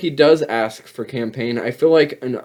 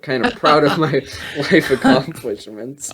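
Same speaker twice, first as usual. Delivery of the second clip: laughing. Real, not acted. Jokes about his achievements.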